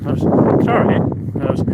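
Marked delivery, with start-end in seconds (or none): 0.6-1.0 short, high-pitched "sorry?"; different mock "sorry". Showing three different sorries, a short high one. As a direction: imitate a brief, pitched-up "sorry?". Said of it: "three different sorries".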